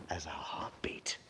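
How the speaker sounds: hushed whisper on the heartbeat. Acted. Playwright teaching rhythm, whispering the heartbeat line. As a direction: drop to a breath of a whisper.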